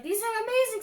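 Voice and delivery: high, loud gushing praise; gushing excited. Retold or imitated. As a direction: rave about it like a fan. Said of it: "So they're like".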